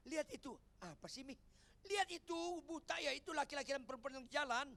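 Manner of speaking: high, loud insistent nudging; eager wife. Acted. Acted wife urging husband to look.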